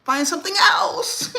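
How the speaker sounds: high, slow and sing-song; mock sweet voice. Teasing the viewer in a high sweet voice. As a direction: coo it like a teasing offer.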